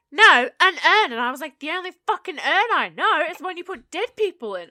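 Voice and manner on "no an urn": loud, slow, stubborn repetition; insistent customer. Retold or imitated. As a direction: hammer the word home to a confused clerk.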